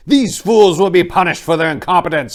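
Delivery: angry cry. Acted. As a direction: bellow it as an enraged officer. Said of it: "he cried in anger".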